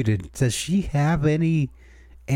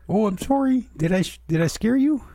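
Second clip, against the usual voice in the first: polite ghost; 9 semitones higher, faster than usual. Pretend. Plays polite Canadian ghost.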